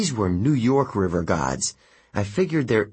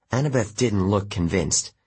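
ordinary delivery, nothing marked

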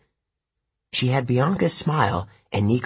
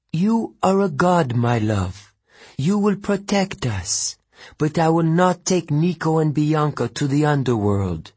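put-on Italian accent; 6 semitones higher than usual. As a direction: soothe her lover, then refuse, Italian accent. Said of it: "She spoke with an Italian accent".

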